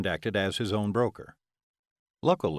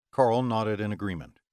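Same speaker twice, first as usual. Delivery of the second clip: ordinary delivery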